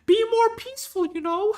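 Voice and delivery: a bad, high falsetto take on the professor; Jordan Peterson impression. Mocking Jordan Peterson's take on borders, squeaky high impression. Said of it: "very bad Jordan Peterson accent".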